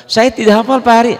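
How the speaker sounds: high, loud mock student protest; complaining student. Student telling the teacher they haven't memorised it, mock complaint.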